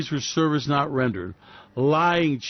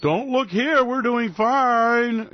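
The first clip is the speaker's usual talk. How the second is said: higher, slow mock reassurance; mock banker; 7 semitones higher, much slower than usual. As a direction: fake calm from a lying banker. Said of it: "And they're going".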